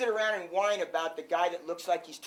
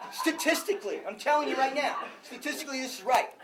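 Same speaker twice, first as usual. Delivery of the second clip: higher, louder, laughing through the words; laughing disbelief; 7 semitones higher, 4 dB louder than usual. Real, not acted. Coach laughing at referees outscoring the wrestlers. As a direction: laugh at how absurd the numbers are.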